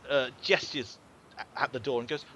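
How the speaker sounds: ordinary talk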